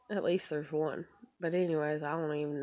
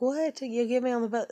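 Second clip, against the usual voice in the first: higher, disbelieving what; incredulous; 6 semitones higher than usual. Retold or imitated. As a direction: pitch it up in outrage. Her own outraged reply.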